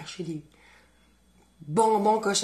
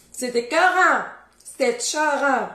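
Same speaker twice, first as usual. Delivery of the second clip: nettement plus haut et plus fort, emballée; s'exclamer de plaisir; exclamation ravie; 6 semitones higher, 6 dB louder than usual. Real, not acted. Exclamation on tasting. (exclamation gourmande)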